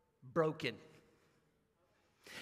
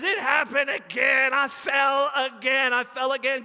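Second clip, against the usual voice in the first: put-on louder, crying-out child's wail; wailing shout; 3 semitones higher, 6 dB louder than usual. Boy with broken arm wailing to dad, loud. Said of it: "And he says".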